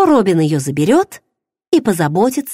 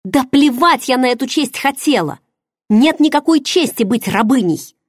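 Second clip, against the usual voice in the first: furious; 7 semitones higher than usual. Acted. Heroine rages at her lover over his bride. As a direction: hurl the accusation at him. Angry retort.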